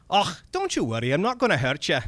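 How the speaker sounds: reassuring little Scottish man; Scottish accent. Acted. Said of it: "my bad Scottish accent comes in now".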